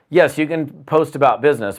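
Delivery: usual speaking voice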